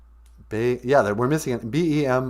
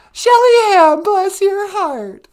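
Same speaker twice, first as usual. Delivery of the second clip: high falsetto, louder and slow; doting falsetto auntie blessing; squealed sweet thanks; 10 dB louder, slower than usual. Acted. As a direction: gush over the donor in a squeaky voice.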